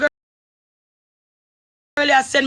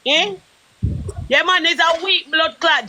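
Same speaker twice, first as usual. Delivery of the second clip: ordinary talk, nothing marked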